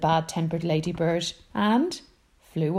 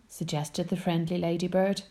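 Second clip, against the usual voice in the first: ordinary delivery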